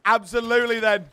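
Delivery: 1 loud exclaim. Real, not acted. Loud excited repeat of catchphrase.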